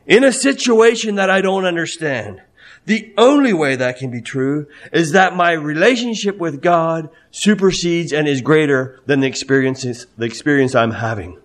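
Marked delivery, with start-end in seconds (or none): none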